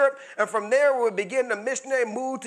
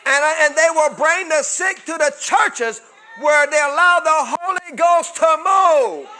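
high, loud preacher's shout, the last words slow; climactic shout; 7 semitones higher, 11 dB louder than usual. Acted. Preacher crying the prophecy's climax to the church, loud slow shout.

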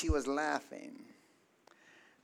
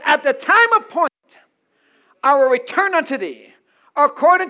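stern; 11 semitones higher, 17 dB louder than usual. Acted. The Lord answers Sarah's disbelief, very stern. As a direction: thunder it like a stern rebuke from God. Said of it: "Then the Lord said sternly".

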